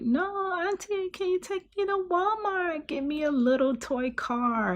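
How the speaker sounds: put-on high, childish pleading kid voice; little kid asking his aunt for a toy car; little nephew begging. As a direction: beg like a small boy wanting a toy.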